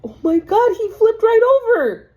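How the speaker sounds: high, loud astonished cry; shocked amazement. Real, not acted. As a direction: exclaim in real disbelief, pitch leaping up. Startled by card turning over.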